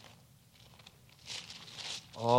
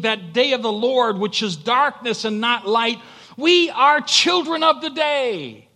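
high, loud pulpit declaration; impassioned preacher's cry; full-force preaching; 11 semitones higher, 8 dB louder than usual. Acted.

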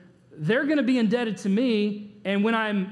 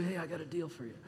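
very hushed mock-mobster voice; mafia voice; 4 semitones lower, 14 dB quieter, faster than usual. Mafia man offers you a deal, hushed mobster impression. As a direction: make the offer like a movie mafioso, very softly. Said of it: "The mafia comes to you".

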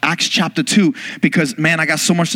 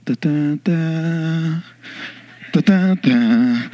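heroic fanfare hummed; much slower than usual. Retold or imitated. Sound as Matt appears.

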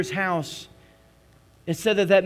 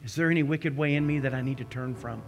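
prayer; 4 semitones lower than usual. Acted. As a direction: lead them gently into prayer. Guiding communion prayer.

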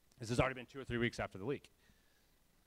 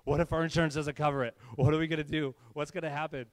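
mock worried; 5 dB louder than usual. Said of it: "Sarah and I were kinda freaking out".

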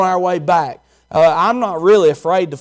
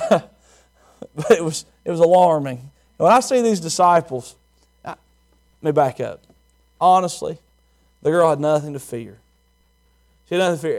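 ordinary talk, nothing marked

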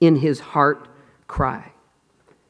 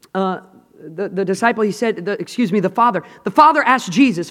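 ordinary talk

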